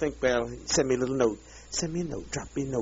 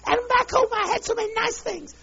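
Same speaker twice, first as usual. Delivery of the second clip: high, whining mockery of a complainer; mock whiny voice; 4 dB louder than usual. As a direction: squeak it out like a spoiled child pining for cake.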